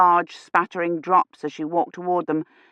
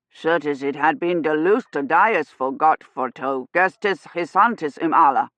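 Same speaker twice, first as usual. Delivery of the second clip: Middle English; 3 semitones lower than usual. A narrator's reading. Man speaks Middle English.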